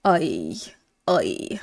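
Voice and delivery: oi sunk very low and drawn out; low, drawn-out oi demo. Shown as a demonstration. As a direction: say oi low and long. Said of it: "we're saying it as a oi".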